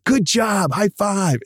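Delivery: praising staff for a job well done; cheery praise. Retold or imitated. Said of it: "And you go".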